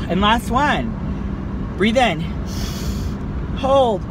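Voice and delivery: guided breathing. Shown as a demonstration. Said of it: "So let's do this together".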